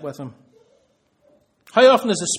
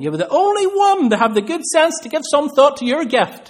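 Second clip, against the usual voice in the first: higher and louder, stressing the only one; emphatic mother; 10 semitones higher, 7 dB louder than usual. Retold or imitated. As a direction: swell up proudly on the repeat. Repeated phrase, build-up.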